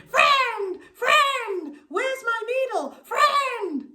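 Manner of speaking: high, loud piercing hawk cry of a voice; keening bird voice calling its friend. A narrator's reading. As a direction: screech it down from the sky like a hawk. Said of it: "still calling".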